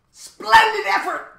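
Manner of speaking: very high, loud, slow hearty shout; jovial bug. A narrator's reading. Humbug congratulates Milo, jovial high shout. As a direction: gloat jovially. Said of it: "commented the bug jovially".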